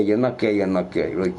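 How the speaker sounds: ordinary delivery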